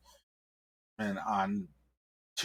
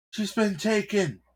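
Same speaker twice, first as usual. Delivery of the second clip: urgent high report; 12 semitones higher, 3 dB louder than usual. Acted. Officer bursts in reporting.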